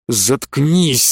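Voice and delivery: сдержанно злая команда; осади его зло, не повышая голоса; злой выкрик. A narrator's reading. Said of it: "зло выкрикнул Инто". (с лёгкой злостью)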